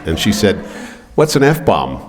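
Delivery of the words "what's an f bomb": higher, guileless question; innocent mom. Retold or imitated. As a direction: ask it like an old lady who has never heard the word.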